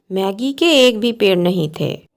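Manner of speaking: ordinary delivery, nothing marked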